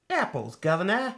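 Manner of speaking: cheeky mock-Cockney declaration; Cockney accent. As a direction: put on a playful Cockney voice. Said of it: "we do this Cockney accent".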